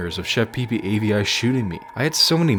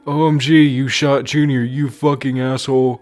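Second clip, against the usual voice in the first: high, loud furious shout; angry outburst; 7 semitones higher, 8 dB louder than usual. A narrator's reading. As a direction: scream at the shooter in rage. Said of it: "Marvin angrily said".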